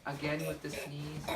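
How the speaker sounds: ordinary delivery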